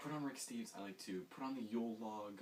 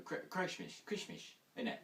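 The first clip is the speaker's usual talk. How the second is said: mock British accent; 9 semitones higher than usual. Jokey mock British Christmas.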